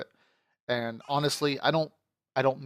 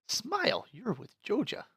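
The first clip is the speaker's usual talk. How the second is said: mock slogan; 8 semitones higher, 3 dB quieter than usual.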